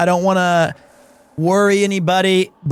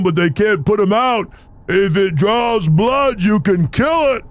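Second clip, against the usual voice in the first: Sly Stallone impression; 2 semitones higher than usual. Streamer imitating Stallone while reading game dialogue. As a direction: lay on a Rocky pep talk. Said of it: "He goes like".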